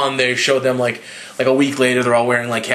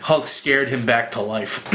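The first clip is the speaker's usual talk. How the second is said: normal talking voice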